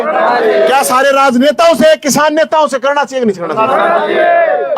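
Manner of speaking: fast, fiery shouted questions to the crowd; rally call and response. Acted. Leader demands everyone speak on SYL, fast fiery shouted call-and-response. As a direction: drive each question home and answer it yourself.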